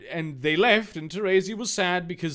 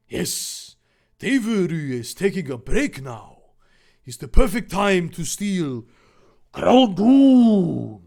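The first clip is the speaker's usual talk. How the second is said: trumpet-like villain voice; 3 semitones higher, 9 dB louder than usual. Acted. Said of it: "Kit Kat said trumpetly".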